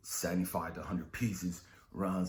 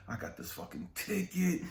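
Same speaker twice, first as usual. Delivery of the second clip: frustrated outburst; 9 semitones higher than usual. Retold or imitated. Dad's stress building to outburst.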